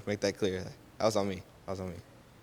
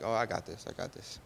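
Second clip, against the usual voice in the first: softer and softer, ending in a whisper; quiet confidence; 8 dB quieter than usual. Retold or imitated. Joseph reassuring himself before Pharaoh, soft then whispered. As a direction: murmur it to yourself, sure of it.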